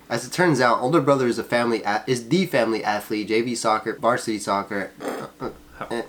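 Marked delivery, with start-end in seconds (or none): none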